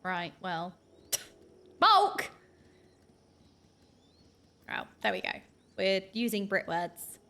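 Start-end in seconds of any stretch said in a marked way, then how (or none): 1.8-2.3 laughing yelp. Real, not acted. Laughing outburst at chat.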